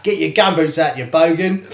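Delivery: loud mock Aussie banter; Australian accent. Teasing the co-host with Aussie slang, hammed-up Australian accent. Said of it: "Please try and do an Australian accent".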